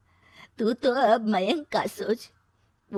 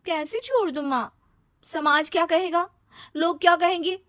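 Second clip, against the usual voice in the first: higher, anxious pleading; worried daughter; 9 semitones higher than usual. Acted. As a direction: fret about what people will say. Daughter refuses to leave husband. (घबराई हुई बेटी)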